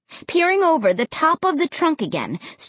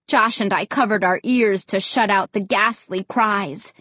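usual speaking voice

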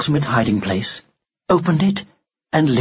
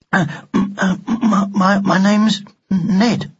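put-on higher boy's voice, halting and stammering; croaky, nervous; 6 semitones higher, slower than usual. A narrator's reading. Frightened boy giving his name to a talking doll, croakily. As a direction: stammer it out after clearing your throat. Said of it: "he said in a croaky voice".

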